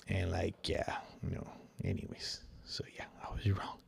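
sheepish whisper, barely voiced; whispered aside. Real, not acted. Admitting to viewers he was wrong, whispered. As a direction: breathe it under your breath like a confession.

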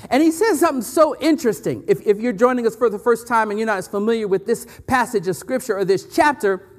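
usual speaking voice

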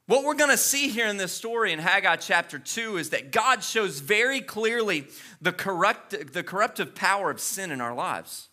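ordinary talk

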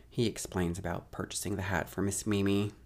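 normal talking voice

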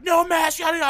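loud, very high mock scolding; shrill nagging impression; mocking shop worker. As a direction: caricature a screeching employee. Mocks shop worker refusing him.

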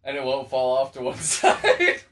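laughing through the words; cracking up at his own joke. Real, not acted. Joke about lopsided shoulders.

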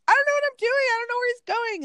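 high-pitched panicky imitation; mock freaking out. Host retelling a prisoner's girlfriend lost on arrival, mock panic. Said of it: "she's so freaking out".

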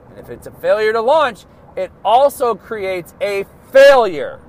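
building from firm to loud and forceful; rising motivational rant; fired-up preaching. Real, not acted. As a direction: hammer the point home like a pep talk.

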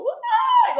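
mock Rachel's terrified yelp at the falling mannequin; scared scream. Rachel startled by the mannequin, mock high shriek. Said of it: "so really scared".

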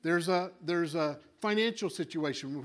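ordinary talk

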